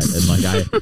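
usual speaking voice

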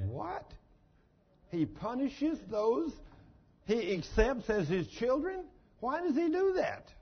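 high incredulous "what", slow rising question; mock disbelief. Preacher feigning shock at the verse to the congregation, incredulous.

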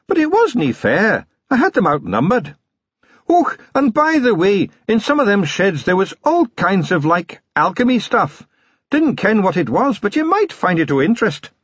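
high, sly Scottish wee man voice; a tiny Scots pixie, sly and proud; sly Scots Feegle. A narrator's reading. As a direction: Wee Mad Arthur boasting slyly to Vimes. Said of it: "said Wee Mad Arthur slyly".

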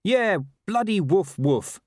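high, slow, sour dog voice; sarcastic dog. A narrator's reading. Talking dog as the man walks off, high sarcastic grumble. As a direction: say the barks as scornful words. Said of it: "said the strange voice".

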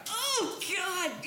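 a hammed-up high oh God; mock moan. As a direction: ham up an overheard moan. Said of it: "accidentally getting that going".

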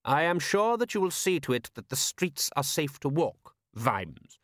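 patrician's sharp instruction; high start, dropping firmly on the name. A narrator's reading. Patrician giving Vimes an order, sharply. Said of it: "said the patrician sharply".

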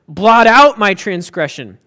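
"Blot out my transgression" said loud as an order; urgent command. Shown as a demonstration. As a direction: command it, do not ask.

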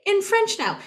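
put-on high little-kid announcement; imitating a small boy, voice lifted high. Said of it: "take it up three octaves".

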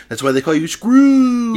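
ordinary talk

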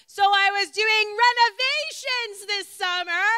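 loud, high shout across a noisy cafeteria; yelling over noise. Acted. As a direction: yell small talk over the din, pitched high. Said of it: "why am I yelling".

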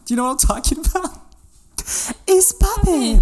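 high excited hype shout; hyped up. Pretend. Hyped repeated catchphrase.

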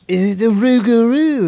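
slow loud hammy French; drawn-out fake French accent; mock French accent.

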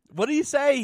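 high, loud startled question; startled stranger. Retold or imitated. The stranger overhears and asks, startled and high. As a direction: snap round in surprise.

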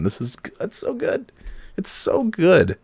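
high, squeaky fake rapture; mock gushing. Mock-gushing over own podcast.

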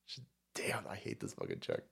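unvoiced under-breath whisper; whispering to himself in disgust; whispered aside. Real, not acted. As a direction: hiss it out of the mic's reach.

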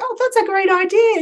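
high, loud retold enthusiasm; enthusiastic approval. Sales girl encouraging a customer's suggestion, enthusiastically. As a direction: cheer the idea on.

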